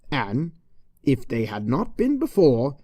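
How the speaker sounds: ordinary delivery, nothing marked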